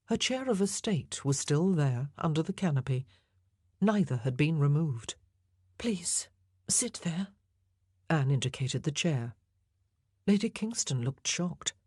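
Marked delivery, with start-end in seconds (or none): 5.8-7.3 soft whisper. A narrator's reading. Anne quietly offers her chair.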